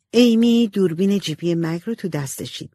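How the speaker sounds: ordinary delivery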